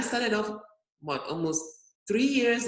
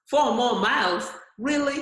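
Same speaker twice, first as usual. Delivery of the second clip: disbelief; 4 semitones higher, 6 dB louder than usual. Retold or imitated. Realizing four miles remain.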